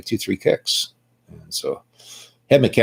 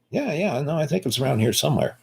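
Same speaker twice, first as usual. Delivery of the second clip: higher, soft and quick, breezy; mock casual; 5 semitones higher, 5 dB quieter than usual. Handing the cop his license, mock breezy. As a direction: rattle it off like it's no big deal.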